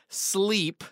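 sleep with air quotes, drawn out and set apart. Shown as a demonstration. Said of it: "quote unquote".

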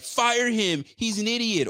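high, mocking yell of an idiot fan; mocking angry fans. Mocking online idiots demanding a firing, high mock yell. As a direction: parody the hotheads calling for a firing. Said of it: "because idiots are like".

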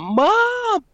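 high, drawn-out reenacted cry for mom; screaming for mom. Disoriented child waking and calling mother, high mock scream. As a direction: imitate a child calling out in alarm. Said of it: "I start screaming for my mom".

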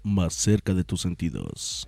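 slow, low radio slogan; deep announcer voice. Acted. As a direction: boom it like a station ID.

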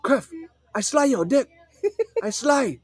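high, hammed-up threatening dad voice; mock-threaten the kid in broken English; Sam Tang impression. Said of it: "I'll do a Sam Tang impression".

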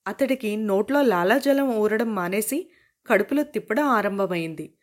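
ordinary delivery, nothing marked

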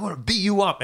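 high cocky taunt; mock cocky warrior taunting the enemy. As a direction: play the badass warrior showing off. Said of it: "getting all cocky".

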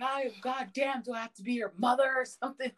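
high-pitched exasperated scolding; retold flip-out. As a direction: imitate yourself losing patience. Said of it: "I really flip out".

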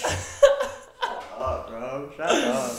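breathless high laughing shut up. Real, not acted. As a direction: squeeze it out through laughter. Laughing at friend's joke.

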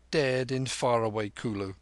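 usual speaking voice

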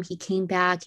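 usual speaking voice

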